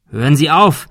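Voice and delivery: loud, urgent call to stop. Acted. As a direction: shout across at the gunman. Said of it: "rief Thomas".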